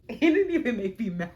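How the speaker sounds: loud laughing disbelief. Real, not acted. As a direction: laugh it out, still amazed. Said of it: "made me gag".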